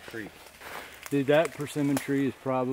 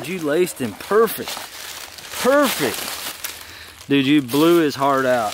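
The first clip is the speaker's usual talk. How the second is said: loud pumped-up praise; excited praise; 2 semitones higher, 9 dB louder than usual. Real, not acted. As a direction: celebrate the shot loudly. Praising the shot.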